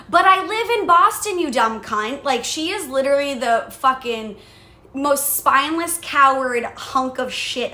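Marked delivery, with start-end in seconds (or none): 0.1-2.2 high, loud incredulous snap; pitched-up, louder exasperated put-down to the boss; retold angry retort. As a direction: fire it back at the boss in disbelief. Retorting to her pushy boss.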